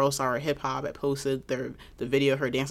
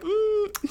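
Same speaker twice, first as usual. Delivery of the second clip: very high-pitched "mm"; high, wordless mock disapproval; disapproving hum. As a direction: squeak out a doubtful hum. Imitating reaction to bad outfits.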